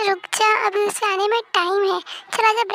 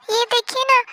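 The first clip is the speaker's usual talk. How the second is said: ordinary talk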